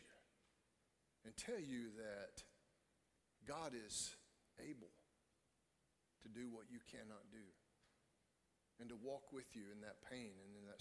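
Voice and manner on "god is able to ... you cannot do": normal talking voice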